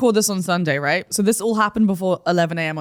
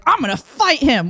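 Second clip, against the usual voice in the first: slow, high, loud mock battle cry; hammed-up manic determination; manic urge, retold; 7 semitones higher, 5 dB louder than usual. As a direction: act out a sudden reckless resolve. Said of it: "manic urge".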